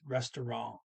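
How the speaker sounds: "restaurant" with a nasal N and no T; nasal ending. Shown as a demonstration. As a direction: end "restaurant" on a nasal vowel, French-like, dropping the T. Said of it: "a nasal only N".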